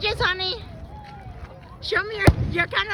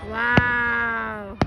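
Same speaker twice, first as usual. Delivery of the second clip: ordinary delivery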